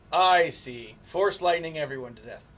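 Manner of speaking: usual speaking voice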